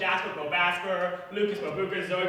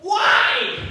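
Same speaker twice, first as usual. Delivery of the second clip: put-on child's loud why; 8 semitones higher, 10 dB louder than usual. Kid demands why.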